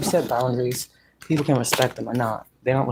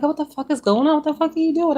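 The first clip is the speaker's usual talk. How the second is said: shocked outcry; 4 dB louder, faster than usual. Retold or imitated.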